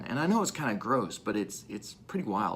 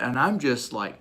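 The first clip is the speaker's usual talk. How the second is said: ordinary delivery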